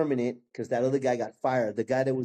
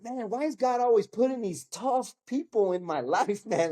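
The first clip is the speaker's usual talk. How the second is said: exasperated complaint; 6 semitones higher than usual. Retold or imitated. Complaining to God about tough bosses.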